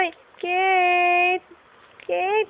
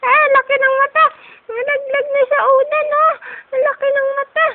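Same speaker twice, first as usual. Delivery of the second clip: amused excitement; 8 semitones higher, 5 dB louder, faster than usual. Real, not acted. Parent teasing the wide-eyed baby who slipped, gleeful squeal. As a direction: exclaim it high and gleeful after laughing.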